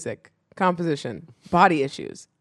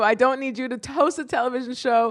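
usual speaking voice

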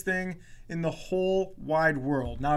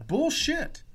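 indignant retort; 11 semitones higher than usual. Retold or imitated. Retorting to the teacher.

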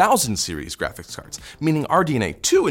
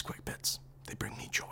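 whisper; 19 dB quieter than usual. Acted. Fake sentimental aside.

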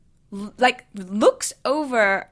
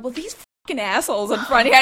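raised voice, re-enacted fury; retold angry rant; 7 dB louder, faster than usual. Wife complaining to her husband about passengers, loud retold anger. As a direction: rant loudly, telling on the culprits. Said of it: "I was so mad".

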